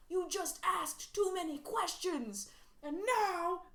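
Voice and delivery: light put-on weird New York movie-villain accent. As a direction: drag out "too many questions" in a weird New York accent. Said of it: "I can't place that accent you just used".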